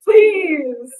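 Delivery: high, loud pleading scold, slow; frustrated conductor begging the choir; exasperated conductor. Pretend. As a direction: beg the choir to keep tempo.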